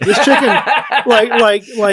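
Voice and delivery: breaking up mid-sentence; can barely get it out for laughing. Real, not acted. Cracking up telling the story.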